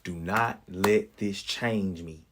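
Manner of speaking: slow, pleading prayer to God; slow earnest prayer. Retold or imitated. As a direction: pray it slowly, each word weighed. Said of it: "I said right then".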